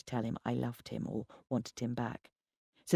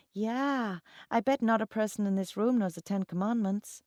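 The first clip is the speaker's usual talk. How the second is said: higher-pitched, eager bar chatter; trainee chiming in; 7 semitones higher than usual. A narrator's reading. Trainee catching up to the conversation, high and eager. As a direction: jump in late with a raised pitch.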